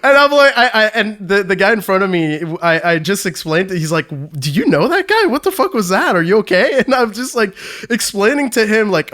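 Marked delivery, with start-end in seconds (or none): 4.4-6.8 high, incredulous questioning; higher-pitched retold disbelief; imitated astonished bystander. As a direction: act out a baffled stranger checking in.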